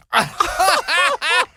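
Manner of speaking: high burst of laughter; high delighted laugh; big laugh. Real, not acted. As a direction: break up laughing at the punchline. After the Ava Gardner punchline.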